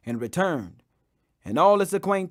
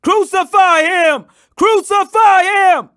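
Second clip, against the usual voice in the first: loud, high, slow shout; slow mob chant screamed for crucifixion; 11 semitones higher, 10 dB louder, slower than usual. Acted. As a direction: bellow it like an angry crowd. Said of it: "they cried".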